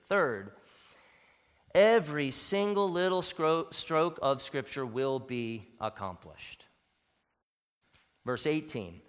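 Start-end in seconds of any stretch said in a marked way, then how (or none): none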